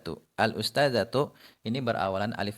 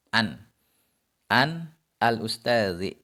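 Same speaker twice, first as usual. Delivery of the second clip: An al-ustazi said slowly, an kept apart; slow separate reading; 3 dB louder, slower than usual. Shown as a demonstration. Teacher gives the separate form of the example. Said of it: "Contoh pada huruf misalnya an".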